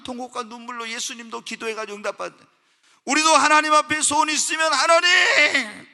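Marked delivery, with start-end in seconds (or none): 3.1-4.7 loud, high, urgent sermon voice; fiery preaching. Acted. Pastor urges the congregation to pray earnestly, in loud fiery preaching. 4.7-5.9 a loud, slow, wailing cry to God. Shown as a demonstration. Pastor demonstrates crying out to God in prayer, with a loud slow wail. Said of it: "막 부르짖어 기도하는 거예요".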